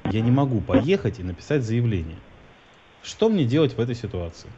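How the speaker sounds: ordinary delivery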